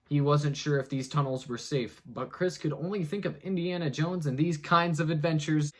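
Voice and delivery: normal talking voice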